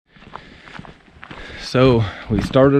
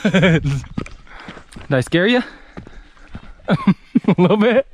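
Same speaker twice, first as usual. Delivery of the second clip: laughing; 7 semitones higher than usual. Real, not acted. Teasing after the scare.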